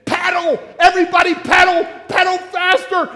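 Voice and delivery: loud, high chant urging the riders on; screaming. Retold or imitated. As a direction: scream the command up the hill. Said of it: "start screaming at the top of our voice".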